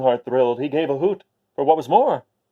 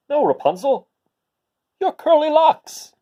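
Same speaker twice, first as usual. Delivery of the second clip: high, louder call up to her; 13 semitones higher, 6 dB louder than usual. Acted. Prince correcting Rapunzel from below, high insistent call. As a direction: call up again, reaching higher.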